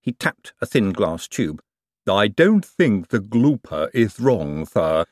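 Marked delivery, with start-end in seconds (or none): 2.1-5.1 slow, lightly voiced gloomy servant; gloomy Igor. A narrator's reading. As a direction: drag each word out mournfully. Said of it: "said Igor gloomily".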